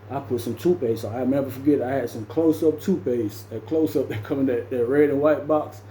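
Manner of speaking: normal talking voice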